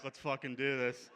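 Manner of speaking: ordinary talk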